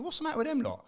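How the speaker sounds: high-pitched bewildered question; puzzled inner voice, pitched high; baffled thought. Retold or imitated. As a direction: wonder aloud what's wrong with them. Retells own baffled thought.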